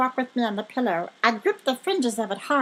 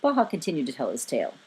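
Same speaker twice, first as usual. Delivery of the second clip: ordinary delivery, nothing marked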